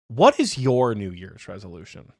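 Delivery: ordinary delivery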